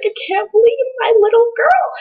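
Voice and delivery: very high, sustained mock wail; gushing dad. Dad overcome about his little girl, mock wailing.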